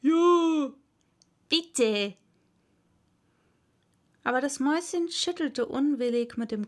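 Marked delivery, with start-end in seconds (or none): 0.0-2.2 drawn-out imitation of a chorus; lightly voiced choral echo. A narrator's reading. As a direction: chime in like a group repeating a plea. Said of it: "die anderen wiederholten im Chor".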